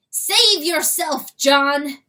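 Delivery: high, loud, slow call; crowed warning. Acted. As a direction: crow the warning out. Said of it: "crowed the rooster".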